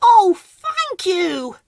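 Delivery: very high, slow, loud squeak; little spider squeal of relief; relieved Whimsy. A narrator's reading. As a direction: draw out the thanks gratefully. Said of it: "said Whimsy".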